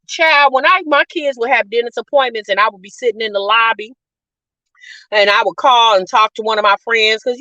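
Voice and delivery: normal talking voice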